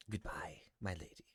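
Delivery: a lightly voiced, soft old Archbishop. GM voicing the Archbishop leaving for his family home, soft and courteous. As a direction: play him quietly and courteously.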